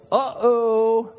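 father at the barber chair finding a flaw, mock worried; dad's uh-oh. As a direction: play the fussy dad spotting a mistake. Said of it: "he would always go".

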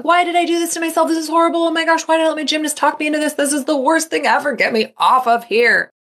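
high, fast, hammed-up panicked wailing; mock panicked rider. Nervous rider regretting the coaster ride, mock panic. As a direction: play the terrified rider begging to get off. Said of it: "the entire experience is you going".

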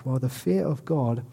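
ordinary talk, nothing marked

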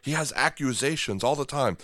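usual speaking voice

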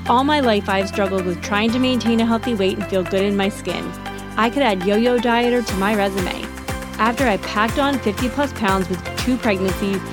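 upbeat scripted intro voiceover; bright host read over music; podcast intro read. Acted.

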